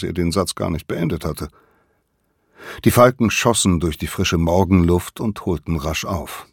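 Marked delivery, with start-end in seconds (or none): none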